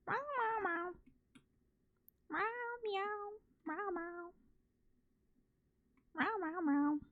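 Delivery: high cooing meows; cat meow. Pretend. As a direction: meow like a kitten. Meowing at a cat.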